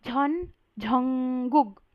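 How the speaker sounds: झॉन झंगुक very slowly, breathy झ; Korean Jeon Jungkook. Shown as a demonstration. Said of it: "इसमें बोलना है आपको झॉन". (झॉन झंगुक बहुत धीरे, कोरियन ढंग से)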